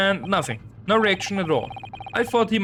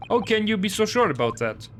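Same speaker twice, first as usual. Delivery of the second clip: normal talking voice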